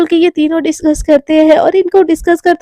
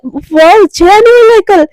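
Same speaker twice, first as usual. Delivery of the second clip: higher, louder thrilled wow; happy excitement; 4 semitones higher, 6 dB louder, slower than usual. Acted. Junior hears her friend is coming, excited and higher. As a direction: burst out with delight at the news. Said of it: "वो एकदम खुश हो जाती है".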